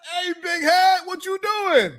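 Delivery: high, mock yell through laughter; laughing high yell. Host mimicking the drunk call, laughing, high. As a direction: yell the line out, cracking up.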